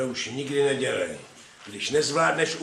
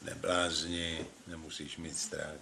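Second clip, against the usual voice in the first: soft low murmur; 6 semitones lower, 9 dB quieter, slower than usual. Acted. Husband at night, bedside.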